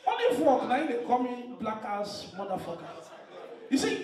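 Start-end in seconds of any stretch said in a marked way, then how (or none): none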